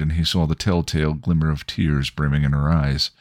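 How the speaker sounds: ordinary delivery, nothing marked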